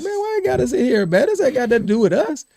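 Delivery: high-pitched, quick mock grumble; griping complaint. Players stuck in a meeting about others, griping. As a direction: whine it high, imitating a fed-up player.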